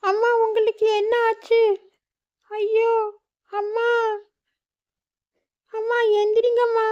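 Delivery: put-on high child voice crying out in panic; a little girl calling her fallen mother; frightened child Meenu. Daughter panicking over her collapsed mother, high frightened cry.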